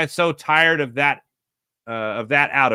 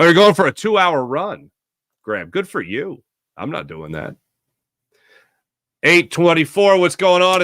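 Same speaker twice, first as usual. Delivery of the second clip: ordinary talk, nothing marked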